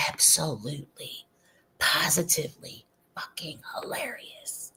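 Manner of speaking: delighted whispered aside. Real, not acted. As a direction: hush it like a secret joy. Gleeful aside about comments.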